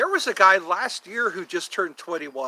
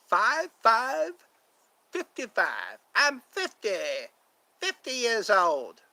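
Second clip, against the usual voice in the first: playful put-on voice; 6 semitones higher, slower than usual. Playing with age in voice.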